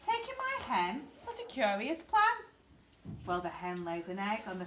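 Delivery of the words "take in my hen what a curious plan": put-on much higher, incredulous old lady; astonished old lady. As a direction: squeak it out in disbelief.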